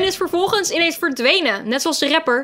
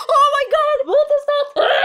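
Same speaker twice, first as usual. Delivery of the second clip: shocked shriek; 13 semitones higher than usual. Real, not acted. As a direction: scream out at the sight. Spots scary red hand. (geschrokken gil)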